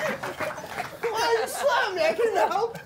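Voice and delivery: high, slow laughing whine; mock hurt whimper; whiny slapped man. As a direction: plead like a slapped fool. Acted slapped man whining.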